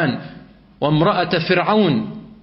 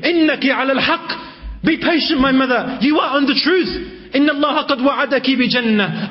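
a lightly voiced child, higher and pleading; infant in cradle; 8 semitones higher than usual. Baby urges his mother to stay patient, higher pleading voice. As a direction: coax the mother gently like a small child.

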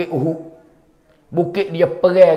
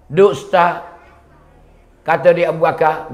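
ordinary talk

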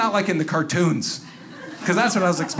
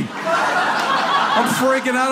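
ordinary delivery

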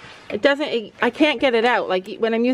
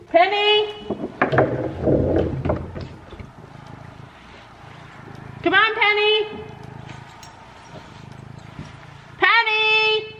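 calling out; 8 semitones higher, 4 dB quieter than usual. Real, not acted. Calling horse across field.

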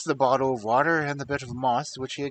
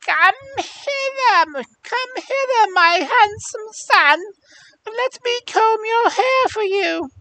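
put-on old hag voice; 7 dB louder than usual. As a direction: croon it like an old witch. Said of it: "she cried".

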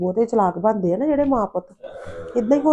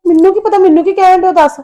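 loud protest; 9 semitones higher, 8 dB louder than usual. Acted. Daughter protests during family quarrel.

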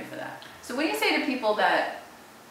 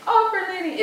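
opera lady; 11 semitones higher, 5 dB louder than usual. Shown as a demonstration. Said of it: "we'll call it Opera Lady".

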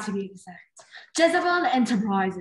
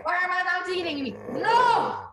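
high, fast panicked cry rising to a scream; panicked villain; 9 semitones higher than usual. Acted. Jezebel panics as her scheme falls apart, shrieking. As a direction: shriek as the plan collapses.

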